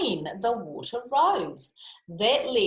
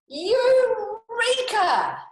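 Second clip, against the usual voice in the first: very high, loud, drawn-out cry; slow, high triumphant Eureka shout; joyful Eureka; 8 dB louder, much slower than usual. Acted. Famous exclamation acted.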